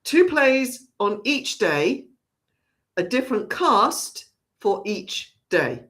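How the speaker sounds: slow, deliberate, spaced-out phrasing; careful enunciation. Real, not acted. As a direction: set out each item carefully, pausing between. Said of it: "have to say that really carefully".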